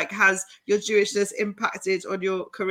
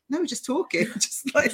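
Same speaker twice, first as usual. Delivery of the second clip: mock innocent friend; 6 semitones higher than usual. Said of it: "And he's like".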